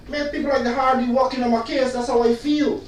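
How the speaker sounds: usual speaking voice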